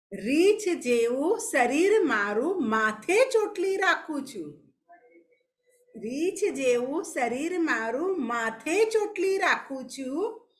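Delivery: slow, singsong riddle verse; riddle recitation. Acted. Teacher poses a riddle to young pupils, slow singsong recitation. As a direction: chant it in measured, drawn-out phrases.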